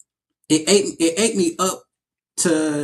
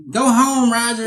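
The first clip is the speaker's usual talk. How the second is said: high, raised-voice sitcom catchphrase; pitched-up, playful dismissal; acted TV catchphrase; 7 semitones higher, 3 dB louder than usual. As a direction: call it out high like a sitcom line. Quoting a TV show catchphrase.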